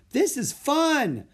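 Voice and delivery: high, loud, drawn-out glee; excited delight. A narrator's reading. Girl on charging elephant, gleeful and excited. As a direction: relish the ride out loud.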